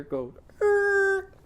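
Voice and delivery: er squeaked very high; high er vowel; er sound. Shown as a demonstration. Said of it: "his sound".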